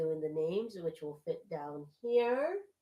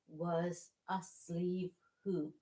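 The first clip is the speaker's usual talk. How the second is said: ordinary delivery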